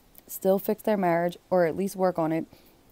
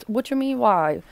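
pitched up in sarcastic disbelief; mock incredulous; 5 semitones higher, 3 dB louder than usual. Host answering the mistress's "why" text, sarcastic disbelief. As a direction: fire it back like she can't believe it.

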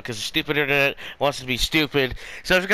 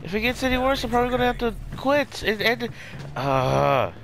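hyped gamer bro; 7 semitones higher, faster than usual. Real, not acted. Exasperated at lag, whining.

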